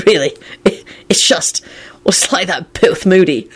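breaking, half-voiceless with mirth; choked out. A narrator's reading. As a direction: struggle to get it out through laughter. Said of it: "Lupin choked out as he wiped tears".

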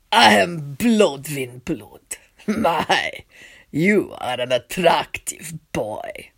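low, slow, whispered leer; leering vampire. A narrator's reading. Vampire prince eyeing the boy, slow creepy whisper. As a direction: savour each word like a predator.

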